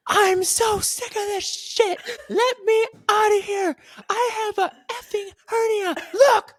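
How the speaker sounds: high, strained mock scream of fury; imitating her screaming. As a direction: shriek it like a furious witness fed up. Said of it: "literally screaming like that".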